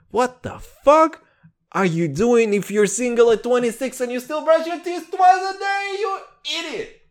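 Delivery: high, exasperated comic rant building to a squeal; mock outrage. Host berates single listeners who still brush twice, mock outrage. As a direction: work up to a high-pitched mock fit.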